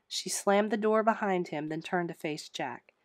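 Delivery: usual speaking voice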